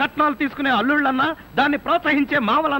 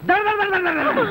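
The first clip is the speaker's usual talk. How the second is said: high rapid దడ దడ gunfire sounds; mimicking gunfire to describe the harsh father; machine-gun noise; 9 semitones higher than usual. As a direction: rattle off the gunshots, pitch up. Imitating machine-gun fire.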